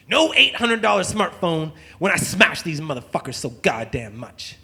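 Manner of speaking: usual speaking voice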